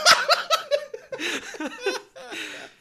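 shrieking burst of laughter. Real, not acted. Big laugh at joke.

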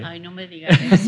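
playful protest through a laugh; laughing mock protest. Real, not acted. Guest to host as the episode wraps up, laughing protest. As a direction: object jokingly, laughing.

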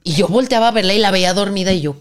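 usual speaking voice

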